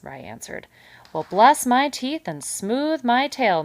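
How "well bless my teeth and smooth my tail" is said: high, loud, slow beaming exclamation; glowing delight. A narrator's reading. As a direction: savour it like great news. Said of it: "Mister Kanad glowed".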